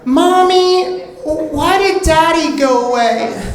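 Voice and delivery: put-on very high, slow child's voice; little kid voice. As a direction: ask it like a four-year-old. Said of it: "So I was like".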